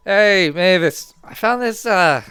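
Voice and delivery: high, loud, drawn-out hail; loud, goofy announcement; calling out to someone. Acted. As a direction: call over to a friend with a find. [name] calls out to Mavis.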